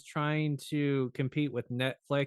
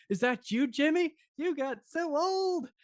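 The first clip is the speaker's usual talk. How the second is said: put-on amazed old neighbor; 14 semitones higher than usual. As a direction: marvel at how old the boy got. Astonished neighbor sees grown kid.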